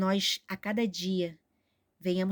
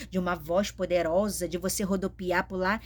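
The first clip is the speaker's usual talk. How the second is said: ordinary talk, nothing marked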